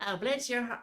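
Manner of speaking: put-on high Southern belle accent. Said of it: "do a Southern accent".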